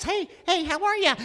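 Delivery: put-on high little boy greeting; squeaky kid voice. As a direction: squeak like a boy not yet grown. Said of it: "And I was like this".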